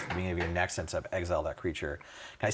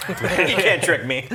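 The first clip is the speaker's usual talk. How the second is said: laughing, slow and loud; 8 semitones higher, 7 dB louder, slower than usual. Real, not acted. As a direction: laugh through the words. Joking about made-up cards.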